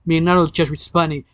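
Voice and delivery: ordinary delivery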